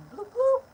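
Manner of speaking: high, quick bloop bloop like a siren; imitated police siren. Telling viewers how the police lit her up, high siren noise. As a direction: chirp it out like a cop car's siren.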